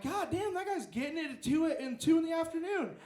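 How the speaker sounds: high, loud incredulous exclaim; shocked remark. Retold or imitated. Shocked reaction to the screaming.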